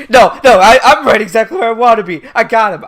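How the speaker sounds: loud, fast mock rant; frantic insistence. Light after losing his cool, frantic loud insistence. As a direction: insist loudly to convince yourself. Acting Light's panicked self-reassurance.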